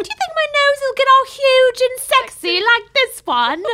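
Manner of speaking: high, loud put-on witch voice; mock girly witch line; quoting a witch. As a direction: squeal it like a vain young witch. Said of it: "I love that part where she's like".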